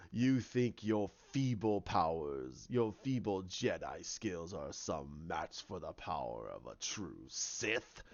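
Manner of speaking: deep, slow, half-whispered villain rasp; Snoke's voice. Retold or imitated. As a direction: loom over him, low and menacing, breathing the words. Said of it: "he's in there going".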